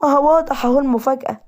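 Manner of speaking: sarcastic. Acted. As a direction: throw his word back at him with scorn. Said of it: "روان بضحكة سخرية".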